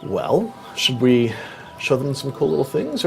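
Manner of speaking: ordinary talk, nothing marked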